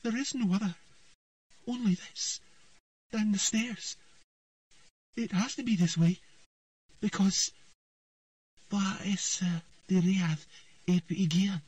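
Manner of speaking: put-on high, dreamy girl's voice; a frightened girl speaking as if in a trance; entranced girl. A narrator's reading. Ciri in a trance at the abyss, high and dreamy.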